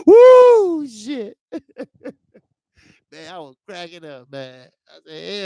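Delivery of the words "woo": high, loud whooping cry of laughter. Real, not acted. Streamer howling at the memory, loud whoop. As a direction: let out a big woo.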